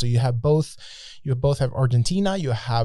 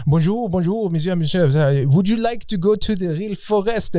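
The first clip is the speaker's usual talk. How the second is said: higher-pitched mock French host; French accent; 5 semitones higher than usual. French Guiana guide inviting tourists to the forest, French accent. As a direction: imitate an eager French-accented tout. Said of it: "it was a French accent".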